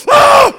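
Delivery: acted falling scream; loud high scream. Speaker acting out a leap off the bridge, screaming. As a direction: scream at full volume, very high.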